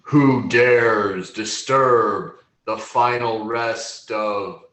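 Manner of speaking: slow, booming growl of an ancient dragon. Acted. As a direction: boom it out like a ghost dragon. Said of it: "this, this deep growl".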